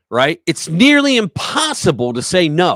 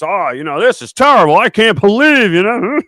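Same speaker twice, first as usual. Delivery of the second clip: higher, louder hammed-up fake dismay; mock Newsom; 5 semitones higher, 5 dB louder than usual. Mocking Gavin Newsom pretending to be shocked, hammed up. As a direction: parody a politician's fake shock. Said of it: "Gavin Newsom goes".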